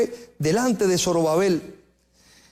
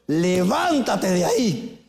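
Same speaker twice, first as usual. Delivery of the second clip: voice of God; 2 semitones lower, much slower than usual. Acted. God orders the prophet to rise, slow and commanding. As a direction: speak as God, slow and weighty.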